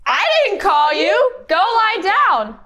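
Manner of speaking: put-on puzzled old man sending the boy back; confused Eli. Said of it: "Eli was confused and said".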